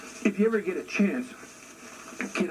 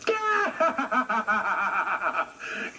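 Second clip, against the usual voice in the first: tiny mocking chuckle; chipmunk giggle; 3 semitones higher than usual. Chipmunk laughing at the bear, tiny mocking giggle. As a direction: giggle like a little critter. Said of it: "in a little tiny voice".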